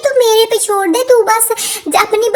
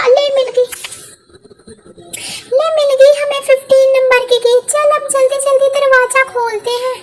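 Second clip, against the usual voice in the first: Kamla's voice; 2 semitones higher, faster than usual. Acted. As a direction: snap back while hunting the key. Kamla voice searching key.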